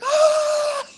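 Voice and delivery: long, very high, loud squeal. Retold or imitated. Siblings scared by mom, imitated long squeal. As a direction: re-enact a drawn-out startled scream. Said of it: "we squeal some more".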